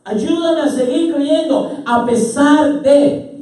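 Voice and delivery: loud, high, drawn-out plea; impassioned shouted prayer; father's prayer. Acted. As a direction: cry out to God slowly and forcefully. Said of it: "como dice este padre".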